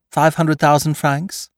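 high, slow questioning offer; teasing high-voiced bargainer; Lupin naming his price. A narrator's reading.

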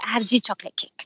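imitated French-accented broken English; mock French accent, storytelling lilt. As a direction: quote it in a thick French accent. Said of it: "kind of in his broken f-, English".

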